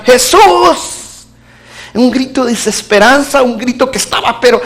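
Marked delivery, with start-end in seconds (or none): 0.0-1.3 high desperate cry of Jesús. Retold or imitated. Father in the car cries out to Jesus, high and desperate. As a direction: cry out the name in panic. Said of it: "lo único que hizo fue gritar".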